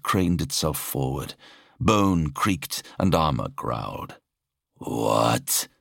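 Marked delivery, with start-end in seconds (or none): 4.8-5.7 low, monstrous master's voice; deep, imperious horned creature; menacing monster lord. A narrator's reading. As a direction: question a servant in a deep creature voice. The Exalted questions the officer.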